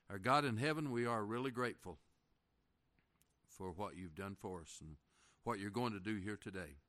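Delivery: hushed closing prayer to the Lord. Real, not acted.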